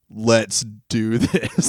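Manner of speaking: slow laid-back cop voice; drawn-out stoner cop; chill cop. Retold or imitated.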